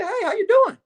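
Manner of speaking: high, strained nervous greeting; nervous greeting to the cop dad. Retold or imitated. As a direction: squeak out a nervous hello. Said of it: "I'm nervous in the motherfucker".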